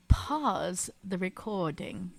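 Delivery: slow, soft attempt at an English accent; English-sounding accent. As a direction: try an English accent, stretched out. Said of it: "That was very English sounding".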